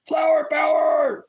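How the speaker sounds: shouted flower power, high and loud; outburst. Real, not acted. Stoner vlogger bursting out to his viewers, loud shout. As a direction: belt it out like a rallying cry. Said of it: "pardon me for my outburst".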